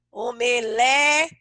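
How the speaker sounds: "omelete" drawn out very slowly, ending split off; syllable by syllable. Shown as a demonstration. Teacher builds the word from syllables for pupils, syllable by syllable. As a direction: stretch "omelete" into separate syllables. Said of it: "Peguei a sílaba o".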